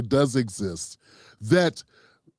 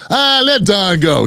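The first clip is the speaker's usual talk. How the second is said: mock exasperation; 4 semitones higher, 8 dB louder, slower than usual. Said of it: "No, I'm joking".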